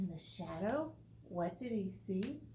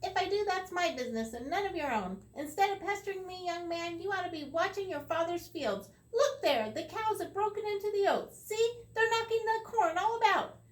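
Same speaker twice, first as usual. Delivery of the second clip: high, louder scolding leprechaun voice; sharp leprechaun; 9 semitones higher, 6 dB louder than usual. Acted. The leprechaun sharply scolds Tom, high and loud. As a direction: snap at him like a cross wee man. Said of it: "answered Tom sharply".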